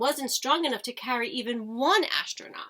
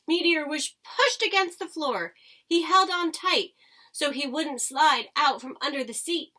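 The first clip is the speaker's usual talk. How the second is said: plain reading voice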